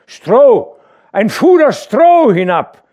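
loud shouted command to the men above; calling out. A narrator's reading. The dike reeve bellowing orders to the workers above. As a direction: bellow the order up over the storm. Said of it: "rief er denen droben zu".

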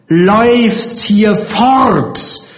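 high, very drawn-out reproach; scharfes Schimpfen. A narrator's reading. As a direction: stretch out the angry scolding, pitch high. Matron scolding the cowering child. (böse gedehnt, mit hoher Stimme)

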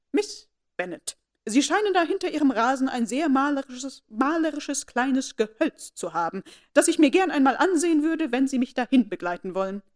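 haughty aristocrat demanding a private walk; commanding lady. A narrator's reading. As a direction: order her out under polite words. Lady Catherine voiced.

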